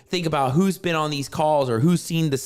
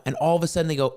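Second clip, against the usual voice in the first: ordinary talk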